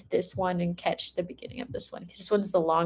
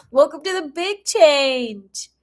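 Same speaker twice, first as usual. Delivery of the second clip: slow, higher, playfully grand repeat; grand welcome; 5 semitones higher, 4 dB louder, slower than usual. Acted. Reader repeating her welcome to the big change, hammed-up. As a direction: lean into the welcome again, drawn out.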